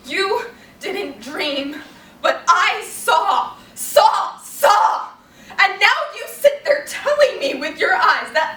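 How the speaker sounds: high, loud, slow and heavily hammered; acted outrage, stretching the key words; anguished accusation. Blanche builds into accusation.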